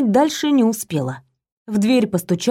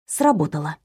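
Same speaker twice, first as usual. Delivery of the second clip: ordinary delivery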